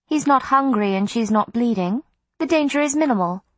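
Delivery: high, lightly voiced vampire lady, matter-of-fact; Miriam's voice. A narrator's reading. Miriam reassuring Em, high and cool. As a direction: toss off the danger coolly.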